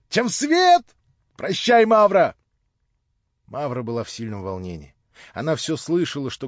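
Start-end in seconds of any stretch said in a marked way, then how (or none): 0.1-2.4 high, loud, happy call; calls out cheerfully on his way out; excited farewell. Acted. (радостный громкий возглас)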